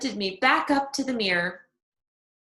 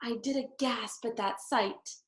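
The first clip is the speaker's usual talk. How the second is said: ordinary delivery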